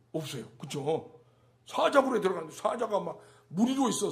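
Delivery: ordinary talk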